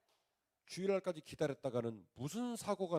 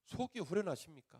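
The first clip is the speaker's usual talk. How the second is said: higher, louder indignant plea; a retold furious prayer, higher and louder; angry prayer; 5 semitones higher, 5 dB louder than usual. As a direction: cry out to God in protest. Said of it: "막 열받아가지고".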